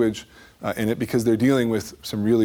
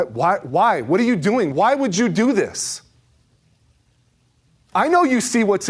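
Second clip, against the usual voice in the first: higher, louder, pleading; anguished pleading; 10 semitones higher, 5 dB louder than usual. Acted. Speaker crying out to Jesus in disbelief, higher and louder. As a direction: cry out at him in disbelief.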